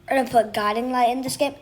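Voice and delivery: ordinary talk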